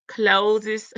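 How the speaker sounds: "closes" said with an extra syllable, CLOSE-iz; misreading read out. Retold or imitated. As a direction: stretch out the mistaken "closes". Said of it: "It's supposed to be closed".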